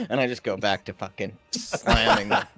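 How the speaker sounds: ordinary talk, nothing marked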